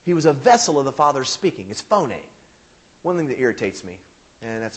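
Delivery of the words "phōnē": "phone" said in two syllables, FOH-nay; Greek pronunciation of phone. Shown as a demonstration. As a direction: add an "ay" ending to "phone". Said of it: "it's pronounced phone".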